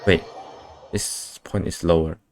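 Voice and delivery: ordinary talk, nothing marked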